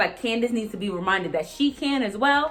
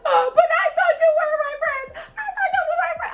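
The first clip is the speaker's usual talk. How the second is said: much higher, laughing mock-hurt whine; mock Candace whine; 4 dB louder than usual. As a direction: parody her wounded friend act. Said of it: "she's like".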